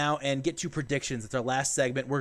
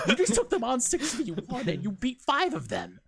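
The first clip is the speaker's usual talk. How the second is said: ordinary talk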